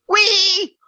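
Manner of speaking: put-on a kid squealing wee to disrupt the chant; squealed wee. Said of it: "the kid goes".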